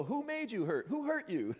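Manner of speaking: high, indignant mock questioning; mock protective friend. Imitating someone comforting a hurt person, in high mock outrage. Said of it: "We go".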